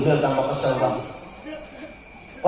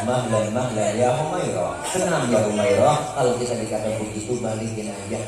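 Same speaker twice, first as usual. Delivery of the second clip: calming; 5 semitones lower than usual. Retold or imitated. The Prophet calming Aisyah. (suara lembut menenangkan)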